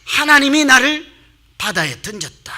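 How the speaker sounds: high, loud burst on 하나님이 나를, then easing down; Jonah's emphatic prayer. Acted. Jonah praying inside the fish, emphatic proclamation. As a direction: proclaim it at full voice, then settle. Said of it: "기도할 때 뭐라고 그래요".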